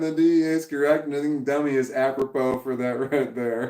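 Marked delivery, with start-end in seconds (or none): none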